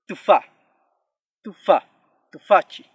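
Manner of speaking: "tufa, tufa, tufachi" loud, one word at a time; vowel after T. Shown as a demonstration. Teacher gives examples with T, loud careful demonstration. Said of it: "la T, por ejemplo".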